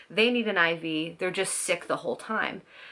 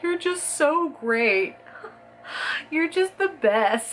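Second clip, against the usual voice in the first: high, slow, teary gushing through sobs; tearful gushing; 9 semitones higher, 4 dB louder, slower than usual. Retold or imitated. Pregnant wife crying to husband, high slow teary gushing. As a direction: blubber the praise, overcome with love. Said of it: "I was just crying my eyes out".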